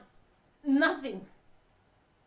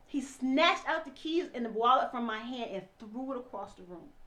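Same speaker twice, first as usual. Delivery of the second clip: usual speaking voice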